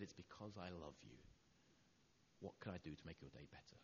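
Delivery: quiet, loving reassurance; tender. Retold or imitated. Husband comforting his tearful wife, soft and tender.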